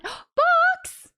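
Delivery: very high, loud shout-out after a gasp; thrilled surprise. Real, not acted. Streamer reacting to another big gift, thrilled. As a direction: cry out the name, then gush thanks. Another ten gifted subs.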